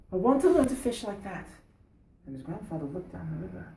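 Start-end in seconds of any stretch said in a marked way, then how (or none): 0.1-1.5 put-on higher, louder, earnest young boy; lightly voiced eager young lad. Boy asking grandfather to let him learn spearfishing, eager.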